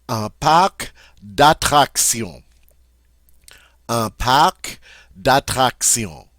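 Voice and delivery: teacher modelling "parc"; model French pronunciation. Shown as a demonstration. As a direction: say "Un parc d'attractions" slowly, C clearly heard. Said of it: "we do say the C in park".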